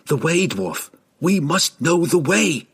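insistent demand rising in pitch; pressing urgently. A narrator's reading. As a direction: press the dwarf hard for an answer. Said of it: "Drizzt pressed harder".

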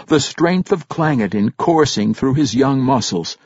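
ordinary delivery

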